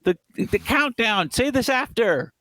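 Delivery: high, strained exasperated outburst; panicked urging. Retold or imitated. Viewer to speechmaking crew during countdown, panicked urging. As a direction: plead in a squeezed high voice, slowing.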